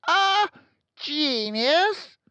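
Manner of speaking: very high, loud outraged shout. A narrator's reading. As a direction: shout it in disbelief. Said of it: "she shouted".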